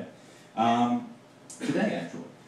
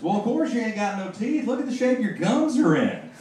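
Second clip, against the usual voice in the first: high, louder bumpkin retort; 9 semitones higher, 4 dB louder than usual. Retold or imitated. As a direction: blurt it like a clueless country boy. Hick boy punchline.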